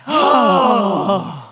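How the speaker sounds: loud cried-out sigh; big loud sigh. Shown as a demonstration. Teacher wants to hear a big loud sigh. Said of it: "And sigh".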